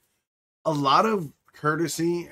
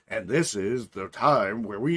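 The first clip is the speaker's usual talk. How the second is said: slow, lower, dramatic ad-announcer read; commercial voiceover; 4 semitones lower, slower than usual. Retold or imitated. Describing a commercial to his cohost, slow announcer voice. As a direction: narrate it like a TV commercial. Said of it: "those commercials where the commercial's like".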